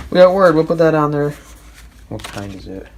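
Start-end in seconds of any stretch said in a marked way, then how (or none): 0.1-1.3 excited. Real, not acted. Excited at finding Word.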